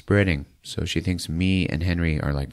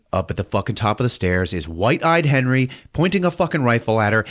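usual speaking voice

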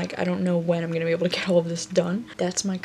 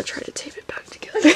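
whispered resolve; 8 semitones higher than usual. Real, not acted. Quiet resolve after breakdown.

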